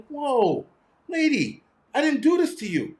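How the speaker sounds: high-pitched mock alarm; startled protest. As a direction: back off in exaggerated, high-voiced protest. Retold startled protest to the yeller.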